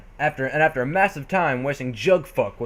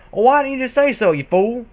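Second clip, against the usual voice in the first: loud, higher scold; 8 semitones higher, 5 dB louder than usual. Acted. Applejack scolds her cousin for not just asking, loud. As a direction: snap at him like a fed-up cousin. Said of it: "she said in a scolding tone".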